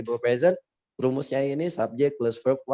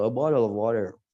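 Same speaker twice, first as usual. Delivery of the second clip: American accent. Shown as a demonstration. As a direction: say it with easy American flapped t's. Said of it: "kalau Amerika enak gitu". (logat Amerika, "bottle of water" jadi "borel ov worer")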